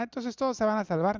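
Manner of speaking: lightly hammed-up misreading; mock misreader. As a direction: repeat the silly wrong conclusion with fake glee. Mocking the same misreading.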